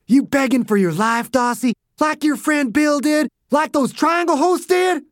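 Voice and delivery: mock his begging; mocking taunt. Dew mocking the pleading man.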